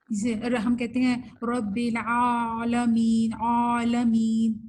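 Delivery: عالمین drawn out slowly with a heavy, thickened ain, the mistake to avoid; slow demo of the wrong, thick عالمین; demonstrating mispronounced رب العالمین with a thick ain. Shown as a demonstration. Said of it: "موٹا کر دیتے ہیں، نہیں".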